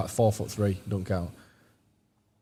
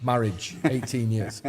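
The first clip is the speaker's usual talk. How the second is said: usual speaking voice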